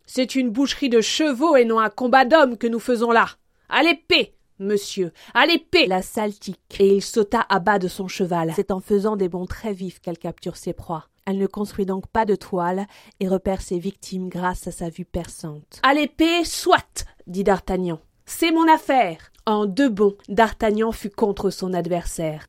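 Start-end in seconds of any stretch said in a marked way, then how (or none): 0.0-5.9 raised, higher-pitched call to arms; rally them to draw swords; battle challenge. Acted. Man halting a fight to demand swords, loud challenge. 15.8-17.1 high, spirited duellist's reply; defiant acceptance. Acted. D'Artagnan accepting a duel, high and spirited. Character accepts the sword fight. 18.2-19.3 high, drawn-out heroic declaration; bold claim. Acted. D'Artagnan claiming his opponent, high and bold.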